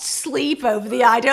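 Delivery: ordinary delivery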